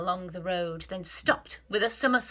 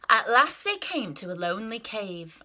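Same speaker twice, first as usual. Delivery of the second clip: plain reading voice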